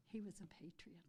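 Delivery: emotional whisper. Real, not acted. Emotional tribute to late uncle.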